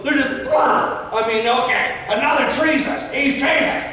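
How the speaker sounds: high, loud, hammed-up mockery of liberals; mock outraged liberals; mocking outrage. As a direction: ridicule their panic, overblown.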